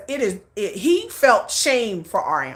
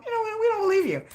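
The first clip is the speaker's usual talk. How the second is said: high-pitched, softer mimicry of doubters; mock viewers; 12 semitones higher, 3 dB quieter, faster than usual. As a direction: put on a high voice for the sceptical audience. Mimics doubting viewers talking back.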